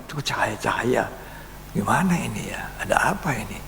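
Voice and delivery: hushed, alarmed whisper; startled whisper. Retold or imitated. Preacher startled by lights in his eyes, whispering. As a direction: whisper in sudden worry. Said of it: "Saya kaget".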